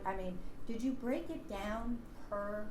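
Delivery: ordinary delivery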